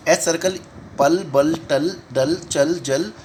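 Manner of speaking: normal talking voice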